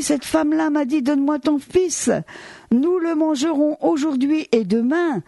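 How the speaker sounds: desperate mother. Acted. A mother tells the king of the famine, high pleading voice.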